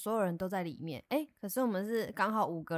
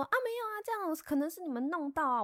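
mock neighbor; 8 semitones higher than usual. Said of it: "然后他就说".

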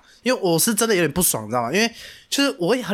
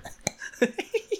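high, much softer airy laugh; breathy laugh; 14 semitones higher, 8 dB quieter than usual. Real, not acted. Teller laughing at the awkward silent drive. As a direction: let a soft laugh slip out. Laughs after the sad ending.

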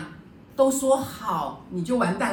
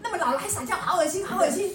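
mock disgust, much higher, laughing through it; disgusted student; 9 semitones higher than usual. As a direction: retell the student's squeamish 好恶心 with a giggle. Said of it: "他说".

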